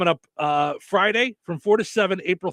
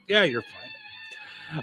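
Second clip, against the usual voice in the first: bored airport agent; 6 dB quieter, much slower than usual. Retold or imitated. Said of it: "They're like".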